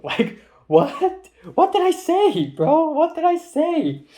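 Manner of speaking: high, loud, disbelieving cry; incredulous outburst. Real, not acted. Baffled by a friend's sudden insult, high loud disbelief. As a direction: squeal it like you can't believe it.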